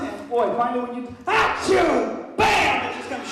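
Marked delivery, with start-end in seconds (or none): 1.2-2.9 loud, hammed-up sneeze and bang; mock sneeze and shout. Telling the crowd how it burst out, loud mock sneeze. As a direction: blast it out like the moment hit.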